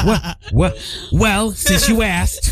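put-on slow, drawn-out Italian accent. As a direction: lay on an Italian mom accent. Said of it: "You do the best Italian accent".

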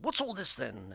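mock English accent. Shown as a demonstration. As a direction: ask it like a stock English character. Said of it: "that's my English accent".

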